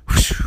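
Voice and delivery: breathy whoosh of wind. Acted. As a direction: blow it like a gust. Wind gust sound effect.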